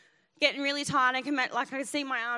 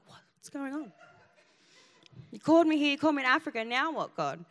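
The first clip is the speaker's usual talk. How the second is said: terrible attitude; 3 semitones higher, 3 dB louder than usual. Retold or imitated. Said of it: "I had this terrible attitude".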